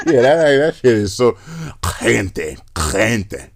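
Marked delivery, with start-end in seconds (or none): 2.0-3.5 gargled Castilian Spanish throat sound; mock Spaniard accent. Mocking the Spain accent, gargled Castilian throat rasp. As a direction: exaggerate the raspy J of Spain. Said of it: "talk like they're just gargling".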